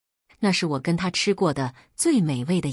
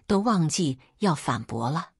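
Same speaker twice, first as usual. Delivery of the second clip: ordinary delivery, nothing marked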